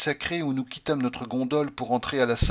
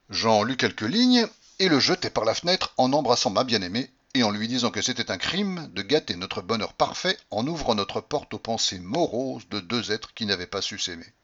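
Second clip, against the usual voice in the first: plain reading voice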